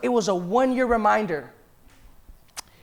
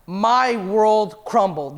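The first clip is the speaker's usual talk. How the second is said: very slow, heavy; much slower than usual. Real, not acted. Diagnosis news hits the pastor.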